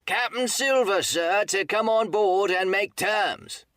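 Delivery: very high, loud, slow hail; shouting. A narrator's reading. Silver's man replies to the captain, high drawn-out shout. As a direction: holler each word across the distance. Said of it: "he shouted".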